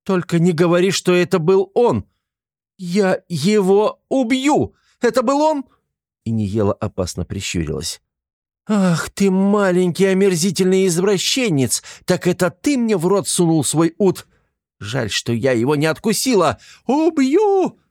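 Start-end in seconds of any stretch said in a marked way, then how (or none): 0.0-5.6 much higher, louder enraged cry; girl shrieking in rage at the dwarf; furious threat. A narrator's reading. 8.7-17.8 put-on girl shrieking in rage at the dwarf; furious threat. A narrator's reading. Girl rages at pervert.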